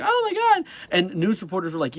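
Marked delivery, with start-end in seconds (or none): 0.0-0.6 high-pitched imitated shriek of alarm; squealed retold scream; mock scream. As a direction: mimic a startled reporter's cry, pitched way up. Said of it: "she screamed and was like".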